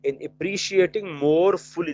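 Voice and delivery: slow, word by word, for taking notes; dictating at a crawl; slow dictation. Shown as a demonstration. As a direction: read it out slowly so they can write. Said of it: "देखो लफ्ज आ रहे हैं".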